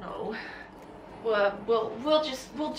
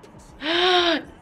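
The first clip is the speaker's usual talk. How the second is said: loud surprised gasp; sharp intake of breath; 8 semitones higher, 6 dB louder than usual. Real, not acted. Oil spills on the page.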